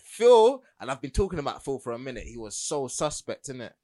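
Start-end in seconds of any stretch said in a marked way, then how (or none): 0.0-0.6 name shot out much higher and louder; exasperated call. Retold or imitated. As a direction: call him out, fed up. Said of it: "it's like".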